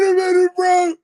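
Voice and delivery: high-pitched, laugh-choked plea; overcome with laughter. Real, not acted. Reactor stopping everyone after the preacher's retort, overcome with laughter. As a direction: squeal it through helpless laughter.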